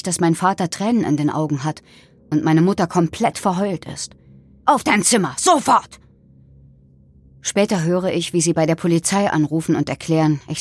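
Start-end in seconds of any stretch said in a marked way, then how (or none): 4.7-6.0 higher-pitched, emphatic command; angry parental order. A narrator's reading. Father sending his daughter to her room, angry order. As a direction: order the child off, no argument allowed.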